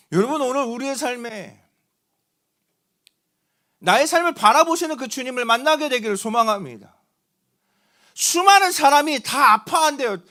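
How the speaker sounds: fervent preaching. Acted. Pastor preaching to the congregation about Jesus seeing the widow, fervent. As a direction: preach it with rising fervor to the congregation.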